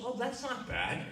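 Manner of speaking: high, light mock mom voice, laughing through it; a cheerful mom who shrugs off the loss; breezy mom. Player's mom brushing off son's lost championship, laughing high.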